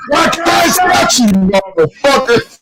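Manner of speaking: high-pitched mock holler out the car window; hollered insult. Driver yelling at another driver from his car window, high mock holler. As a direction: yell it at him as you drive past, way up high. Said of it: "and then I hollered at".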